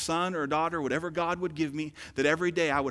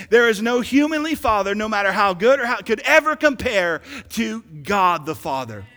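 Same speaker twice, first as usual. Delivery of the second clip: louder, fuller preaching on the second try; preached louder; 5 semitones higher, 10 dB louder than usual. Shown as a demonstration. Preacher retrying the line for the church, louder. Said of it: "Let me try it again".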